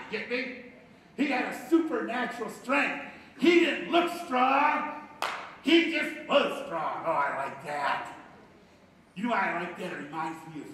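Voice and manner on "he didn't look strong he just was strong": slow, loud, stressed on didn't and was; slow emphasis. Real, not acted. Preacher driving home Samson's hidden strength, slow loud emphasis. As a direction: hammer each word slowly. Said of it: "emphatic preaching".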